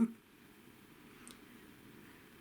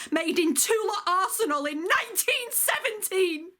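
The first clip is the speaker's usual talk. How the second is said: high-pitched, incredulous plea, climbing to the end; impassioned protest; 5 semitones higher than usual. Acted. Soldier recalling women sent unready to war, impassioned protest. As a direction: protest with rising pitch and disbelief.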